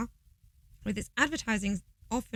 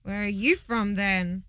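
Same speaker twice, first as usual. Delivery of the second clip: ordinary talk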